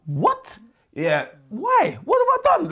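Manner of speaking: very high, incredulous protest; mock shock. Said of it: "we're shipping you out".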